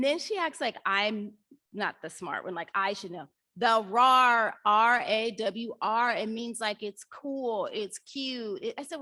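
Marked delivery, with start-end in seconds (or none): 3.6-6.2 loud, slow R A W R spelled out; a mock roar spelled slowly; emphatic spelling. Shown as a demonstration. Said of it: "spelling out teen slang".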